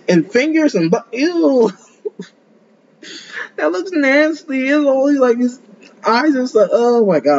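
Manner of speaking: high, drawn-out grossed-out voice; disgusted. Real, not acted. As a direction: squeal in disgust at the monster. Disgusted by a monster.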